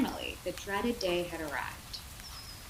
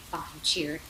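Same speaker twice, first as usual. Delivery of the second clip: plain reading voice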